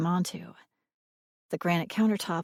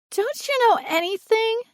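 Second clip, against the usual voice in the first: put-on high, loud, scornful little girl; childish contempt; 6 dB louder than usual. A narrator's reading. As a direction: scold a clueless grown-up in a small child's voice. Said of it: "she sure knew how to voice contempt".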